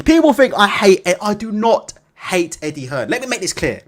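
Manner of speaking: normal talking voice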